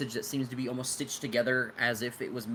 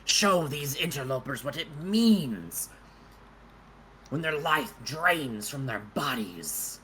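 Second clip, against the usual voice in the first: slow, high menacing incantation; ominous summoner; 3 semitones higher, 3 dB louder, slower than usual. Acted. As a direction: intone it like a dark ritual. Undead summoner calls forth dead.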